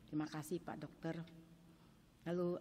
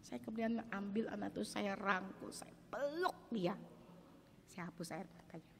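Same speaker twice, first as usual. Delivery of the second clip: ordinary talk, nothing marked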